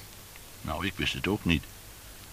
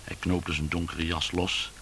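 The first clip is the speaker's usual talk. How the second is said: ordinary delivery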